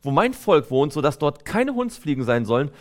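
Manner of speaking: ordinary talk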